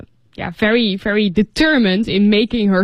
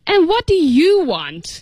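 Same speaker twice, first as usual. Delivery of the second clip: annoyed; 7 semitones higher than usual. Acted. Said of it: "asked in an annoyed tone of voice".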